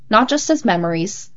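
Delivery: ordinary delivery, nothing marked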